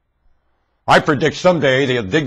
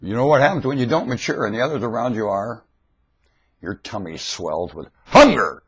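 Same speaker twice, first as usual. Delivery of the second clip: usual speaking voice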